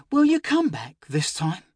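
put-on high, small hopeful child voice; little girl. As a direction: ask like a frightened little girl. Sick child asks hopefully.

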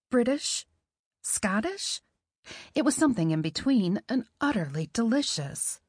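usual speaking voice

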